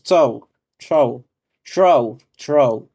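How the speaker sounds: "throw" repeated as "tou", "tow", then "trow"; English pronunciation demo. Shown as a demonstration. Teacher showing students grapheme to phoneme, repeating English words. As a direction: try "throw" several ways, ending on a rolled r. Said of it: "cómo se convierte de un grafema a un fonema".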